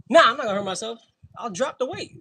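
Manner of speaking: loud, high, laughing it off; loud breezy reassurance; loud protest. Real, not acted. Reassuring someone loudly at gym.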